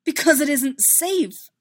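desperate insistence; a little desperate. A narrator's reading. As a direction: press her to understand. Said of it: "a little desperate to make her understand".

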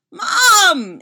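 high, loud shriek for mom; panicked shriek. A narrator's reading. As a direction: shriek it out, long and undignified. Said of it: "he shrieks".

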